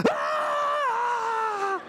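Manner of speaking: short high piercing shriek; hammed-up alarm scream; kids' panic scream. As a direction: shriek like a room of panicked kids. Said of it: "and everyone goes".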